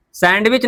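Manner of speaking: "सैंडविच" with the D voiced, SAND-witch; "सैंडविच" said as written, sand-witch; D sounded. Shown as a demonstration. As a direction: show the wrong "सैंडविच" with a clear D. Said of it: "डी तो silent है".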